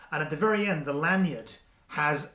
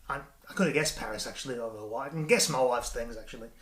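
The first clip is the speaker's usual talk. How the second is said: ordinary delivery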